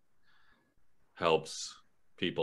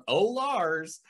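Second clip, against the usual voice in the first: high, affectionate kid imitation; high, light put-on reproach; fond mock scolding; 9 semitones higher than usual. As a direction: play kids amused by a teasing counselor. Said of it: "they're looking back going".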